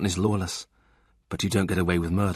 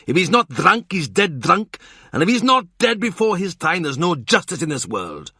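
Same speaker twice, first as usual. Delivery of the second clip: higher, louder escalating list; Morgan voiced; 6 semitones higher, 3 dB louder than usual. Morgan lists the father's crimes, rising. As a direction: hammer each NOT harder.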